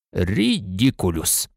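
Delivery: slow, low, drawn-out spell; unhurried, low and casual; lazy. A narrator's reading. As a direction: say the spell lazily, in no hurry. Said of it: "ліниво вимовив Люпин".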